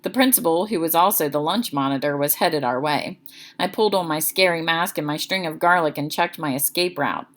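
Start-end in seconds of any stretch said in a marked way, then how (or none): none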